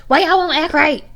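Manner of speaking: high, pushed-out scolding mom voice; imitating her mother scolding the cats; her mother's scolding. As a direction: channel an impatient mom snapping at the kids. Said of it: "that's how my mom is".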